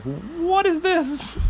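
very high, drawn-out squeal of disbelief; long squeaky "what is this"; mock shock. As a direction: stretch it slowly, pitch leaping way up. Acted shock at dub's German.

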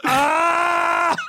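high, sustained mock scream; hammed-up drawn-out cry of agony; scream of pain. Anakin on the operating table, screaming in pain. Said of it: "That's him in pain on the operating table".

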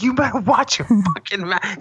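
high mock-tough warning; mock threat. Telling his friend to watch it, high mock threat. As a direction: warn him off in a high squeaky voice. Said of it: "I was like".

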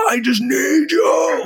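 high, drawn-out mock wail; imitated wailing plea. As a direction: cry out a desperate plea, hammed up. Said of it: "sort of almost whale cry for help".

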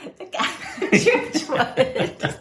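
high, slow words through laughter; real giggles breaking up the words; cracking up. Real, not acted. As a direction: laugh helplessly while finishing the sentence. Cracks up at shared joke.

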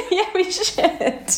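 laughing through the words; breathless giggly agreement. Real, not acted. Giggly plan for friendship anniversary.

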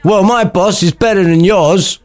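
high put-on boasting voice; rival servant. Servant boasting to his enemy about his boss, put-on voice. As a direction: brag in a high character voice. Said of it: "getting these accents round the right way".

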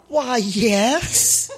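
gasp, then a high, puffed-up reply; pumped-up delight. Retold or imitated. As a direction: act out flattered self-importance. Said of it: "I pumped up and I went".

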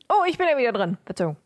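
ordinary talk, nothing marked

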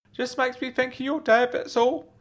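high uncle voice explaining tears; upset uncle. Acted. Uncle tells nephew why he's upset, high-voiced.

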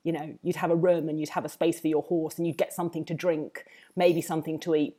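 ordinary talk, nothing marked

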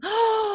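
a sharp high gasp of shock; mock gasp. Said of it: "Oh, look at that".

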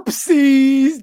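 loud fake whiny cry; mock whimper. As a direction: whimper it like a baby. Mock whimper at bad choice.

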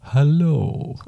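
lightly voiced slow vampire; a hint of a spooky vampire greeting. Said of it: "Do your vampire voice".

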